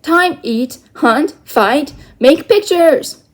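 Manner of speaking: loud, high call to wake the sleeper; shouting. Acted. Little Bear shouts at sleeping Omri to get up. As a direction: bellow it across a canyon. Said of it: "the Indian shouted".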